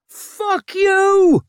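very high, loud scream. A narrator's reading. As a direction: scream it while attacking. Laundress attacks Vic.

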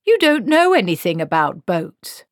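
loud, indignant objection; protesting. A narrator's reading. Wizard trying to stop Granny boating, protesting loudly. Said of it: "Q-Tangle protested".

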